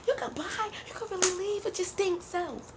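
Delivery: high sassy taunt imitation; mocking friend. Lamaya telling the girl to leave, high sassy taunt. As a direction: tease her in a high sassy voice.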